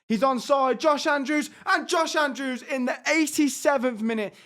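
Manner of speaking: high, loud commentator's eruption; real elation, pitched well up; excited goal call. Real, not acted. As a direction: shout the late goal home. Late goal in the game.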